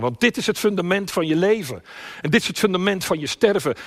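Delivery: high, loud, rising proclamation; impassioned preaching. Real, not acted. Preacher tells congregation this is life's foundation, impassioned. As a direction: drive it home at full force.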